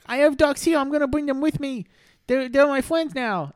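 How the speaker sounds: put-on very high, loud kid; proud little boy, slowing down. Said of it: "Jimmy was like".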